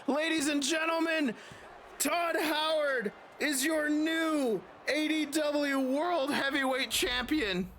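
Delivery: booming, drawn-out title call; excited announcement. Acted. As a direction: crown the new champ with a big slow build. Announcing the new champion.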